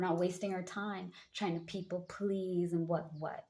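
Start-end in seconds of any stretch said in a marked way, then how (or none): none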